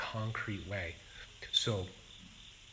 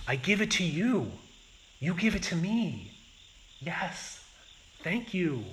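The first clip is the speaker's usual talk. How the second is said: higher, louder voice of a mother playing give-and-take; mother to toddler; 8 semitones higher, 5 dB louder than usual. Japanese mother playing give-and-take with toddler, higher, louder. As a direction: gently trade a toy back and forth with a small child. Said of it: "the Japanese mothers would say something like".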